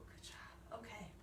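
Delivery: soft whispered praise. Real, not acted. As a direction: breathe it out quietly. Whispered praise after exercise.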